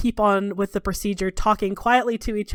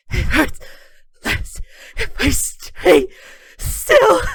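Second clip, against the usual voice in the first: high, strained voice cracking with pain; tortured prisoner begging through pain; pained crying whimper; 9 semitones higher, 7 dB louder, slower than usual. Acted. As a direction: squeeze each word out through agony. Tortured Fenris strapped to table.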